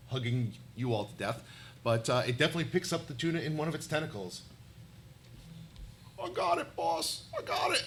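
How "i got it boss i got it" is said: lightly voiced henchman; eager underling voice. Octopus's captive calling to boss, lightly played underling voice. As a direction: report to the boss in a put-on voice. Plays a figure with the tuna.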